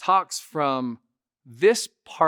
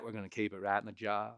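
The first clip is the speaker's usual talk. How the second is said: Matthew McConaughey; 3 semitones lower, 7 dB quieter than usual. Shown as a demonstration. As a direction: talk with front teeth pushed forward. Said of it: "We're gonna speak from there".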